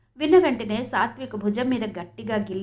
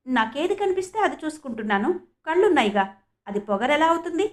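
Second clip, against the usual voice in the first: usual speaking voice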